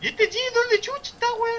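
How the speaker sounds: exasperated. Real, not acted. Annoyed searching for teammate.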